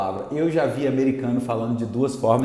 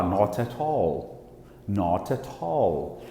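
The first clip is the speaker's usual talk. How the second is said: British "not at all" with crisp t sounds; "Not at all" in British style, both t's sounded; English accent, hard t's in "not at all"; 7 semitones lower, 8 dB quieter, much slower than usual. Shown as a demonstration. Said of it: "o inglês vai falar".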